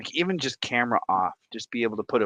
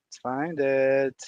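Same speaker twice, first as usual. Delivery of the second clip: usual speaking voice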